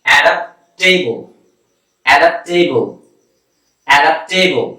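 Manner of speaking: অ্যাডাপ্টেবল repeated slowly and carefully for learners; অ্যাডাপ্টেবল modelled clearly, ending in a falling tone; pronunciation drill. Shown as a demonstration. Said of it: "দেখেন".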